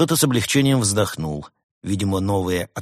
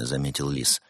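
ordinary delivery, nothing marked

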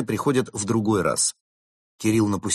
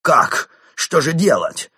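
loud, high gasp of dismay; shocked outcry, pitched up; aghast; 8 semitones higher, 8 dB louder, slower than usual. A narrator's reading. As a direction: exclaim in helpless alarm. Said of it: "Крот охнул".

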